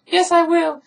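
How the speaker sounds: very high, loud falsetto retort; hammed-up girlish insistence; mock stubborn girl. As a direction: argue back in a squeaky parody voice. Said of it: "she's like".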